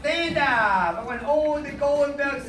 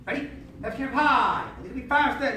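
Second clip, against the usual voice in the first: ordinary talk, nothing marked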